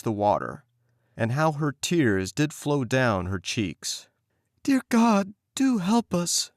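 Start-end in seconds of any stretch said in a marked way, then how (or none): 4.6-6.5 put-on high, pleading child's voice; pleading girl. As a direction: beg heaven for help. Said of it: "she cried".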